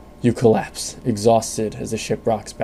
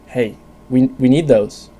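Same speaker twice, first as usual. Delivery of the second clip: louder, stammering protest; 3 semitones higher, 7 dB louder, slower than usual. Acted. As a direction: call out over the rushing wind. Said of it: "loud in the enclosed room".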